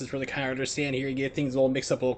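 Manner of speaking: fast Northeast patter; Northeast rapid fire. Shown as a demonstration. Streamer demos the Northeast way of talking, rapid fire. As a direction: rattle it off at breakneck speed. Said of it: "Northeast rapid fire, start talking really fast".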